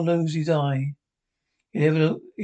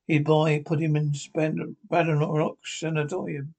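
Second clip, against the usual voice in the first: ordinary delivery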